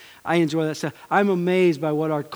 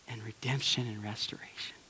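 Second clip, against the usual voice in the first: whisper; 5 semitones lower, 11 dB quieter than usual. Real, not acted. Preacher drops to whisper.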